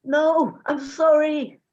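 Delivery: loud, anguished cry of sorry; begging not to be punished; desperate plea. Acted. Boy pleads after father's betrayal.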